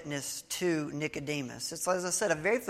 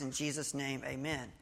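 softer, dutiful repeat of the leader's words; quiet echo; 2 semitones lower, 8 dB quieter than usual. Retold or imitated. The guy repeating the prayer back, quietly. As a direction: murmur it back like a reluctant echo.

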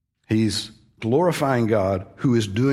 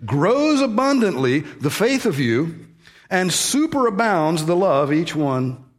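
slow, deliberate literal reading; 6 semitones higher, slower than usual. Shown as a demonstration. Preacher to congregation, reading the literal Greek word order slowly. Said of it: "Literally, this says".

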